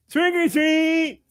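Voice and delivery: very high, drawn-out cleft-palate imitation; hare-lipped little boy. Little boy at the old lady's door, hare-lip voice. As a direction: squeal it slowly as a hare-lipped kid. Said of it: "I'm gonna tell the joke in hair lip".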